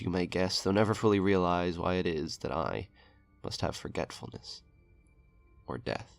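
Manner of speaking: plain reading voice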